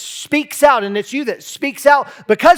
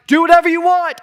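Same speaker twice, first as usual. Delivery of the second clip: mock dismissive culture; 9 semitones higher, 5 dB louder, faster than usual. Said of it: "the whole culture will just sit by and be like".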